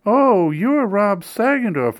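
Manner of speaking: put-on high, slow thrilled old lady; delighted exclaim. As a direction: gush like a starstruck elderly fan. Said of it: "I heard her exclaim".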